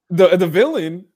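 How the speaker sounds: high, loud disbelieving question; incredulous. Real, not acted. Hearing who the main villain is, incredulous. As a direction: blurt it out in disbelief. Reacting to villain called the foreigner.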